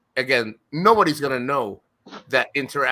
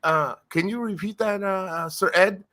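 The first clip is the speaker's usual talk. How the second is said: a gentle imitation of a polite request, pitched up; imitating Filipino coworkers; 8 semitones higher than usual. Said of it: "and Filipinos, they're like".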